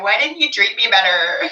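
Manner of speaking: high, loud mock wail; mock plea. Mocking crying over a parent, loud high wail. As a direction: whine it like a wronged child. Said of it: "being like".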